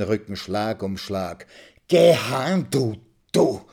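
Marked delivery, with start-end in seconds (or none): 1.9-3.6 higher, louder forced command; angry outburst. Acted. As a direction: hammer the words out with the blows. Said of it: "stieß er stumpf heraus".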